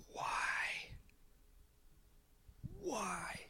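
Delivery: hushed, high, drawn-out exasperation; frustrated. Acted. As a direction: plead wearily, acting head-in-hands despair. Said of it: "he's frustrated, he's worked up".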